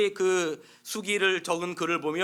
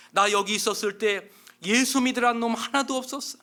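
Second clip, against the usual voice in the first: loud, much higher browbeating sermon; gruff preaching; 6 semitones higher, 6 dB louder than usual. Acted. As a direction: scold him into believing. Inmate browbeating another prisoner.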